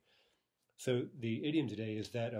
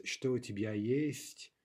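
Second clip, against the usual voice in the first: slow model question; 3 semitones higher, 5 dB louder, slower than usual. Shown as a demonstration. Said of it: "we could ask these questions".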